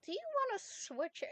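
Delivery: usual speaking voice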